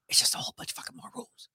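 fast unvoiced whisper; whispered aside. Host slipping listeners a blunt aside on legalization rules, whispered. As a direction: whisper it quickly, like a confidential aside.

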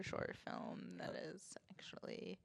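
hushed whisper. Real, not acted. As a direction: breathe the words out softly. Whispered aside.

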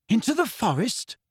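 high-pitched puzzled question; perplexed. A narrator's reading. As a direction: ask it in bewilderment, voice lifted.

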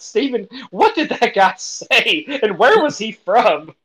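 high, loud, incredulous question; baffled amusement. Retold or imitated. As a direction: draw it out, baffled and close to laughing. Retelling baffled reaction to Welsh accent.

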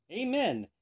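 high, loud one-word command; shouting. A narrator's reading. Rider ordering the mule to stop, shouting. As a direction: call it out to halt a mule. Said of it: "shouted the man".

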